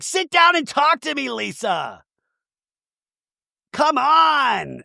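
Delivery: high-pitched imitation of a quarrel; mock screaming demand. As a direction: plead and scold up high, hammed-up. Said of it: "they're just screaming".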